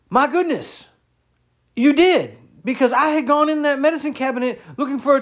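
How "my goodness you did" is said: erstaunt; shocked. Retold or imitated. Shocked reply in retold chat.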